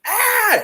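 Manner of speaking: put-on girly scream. Friend panics at a hornet flying into the car, high girly scream. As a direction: let out a high-pitched panicked shriek. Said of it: "same girly scream".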